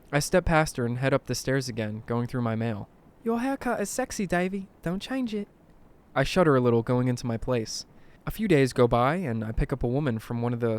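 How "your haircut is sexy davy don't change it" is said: put-on high-pitched English old-lady voice; sweet English granny, pitched high. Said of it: "She's originally from England".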